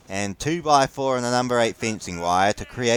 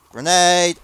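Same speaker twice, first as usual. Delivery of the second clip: sudden higher, louder call; warning shout; 6 semitones higher, 4 dB louder than usual. Real, not acted. Player spotting an incoming grenade, sharp shout. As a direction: yell it out as a warning.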